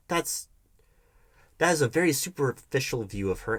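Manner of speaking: ordinary delivery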